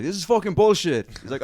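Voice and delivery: loud, high retold heckle; mock outrage. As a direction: imitate an indignant punter shouting up at the stage. Retold audience member stands up angry.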